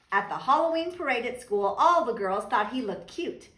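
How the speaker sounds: ordinary delivery, nothing marked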